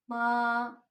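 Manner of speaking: मा held level and flat; first tone. Shown as a demonstration. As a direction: keep मा steady on one pitch. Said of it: "उसी flow से pronounce करना है". (मा सपाट पहले टोन में)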